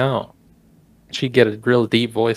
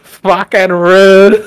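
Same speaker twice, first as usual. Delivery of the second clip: slow, loud Australian drawl; Australian accent; 5 semitones higher, 8 dB louder, much slower than usual. Retold or imitated. Driver grumbling at the kangaroo in an Australian accent. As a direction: stretch it out in a hammed-up Australian accent. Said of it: "Australian accent is great".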